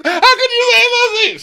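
extremely high, loud squealed wail; mock hysterical. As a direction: shriek it in hammed-up hysterics. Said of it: "start getting hysterical".

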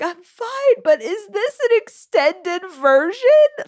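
very high, tearful squeal, hammed-up; mock sobbing. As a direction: wail it in a squeaky, overwrought crying voice. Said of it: "sobbing uncontrollably".

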